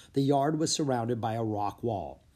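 plain reading voice

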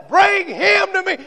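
high, loud ringing shout of bring him to me; shouted command. Acted. Jesus calling the crowd to bring the man, shouted.

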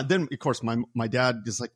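ordinary talk

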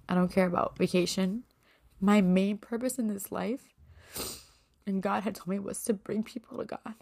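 fighting back tears as she says what matters to her; tearful, voice breaking. Real, not acted. Podcaster moved by a listener's message, speaking through tears. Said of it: "If I get emotional".

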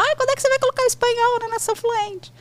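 high, fast mock whine of an impatient follower; whiny fan question. Follower nagging the host for Spanish, mock whine. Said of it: "tem gente que fala".

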